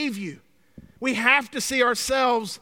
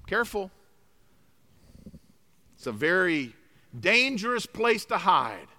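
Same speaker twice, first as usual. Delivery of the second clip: normal talking voice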